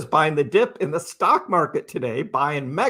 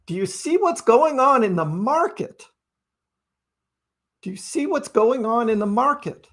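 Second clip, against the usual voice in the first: higher, incredulous, pleading with the doubters; exasperated retort; 7 semitones higher than usual. Retold or imitated. Answering the bears, exasperated and high. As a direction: come on, look at the market, raised pitch. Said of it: "And I'm just like".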